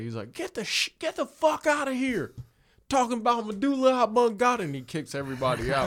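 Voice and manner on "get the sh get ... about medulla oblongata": very high, louder hammed-up tantrum; mock angry yell. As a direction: throw everybody out in a fake rage. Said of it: "he yells at everybody".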